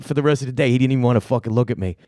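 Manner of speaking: ordinary delivery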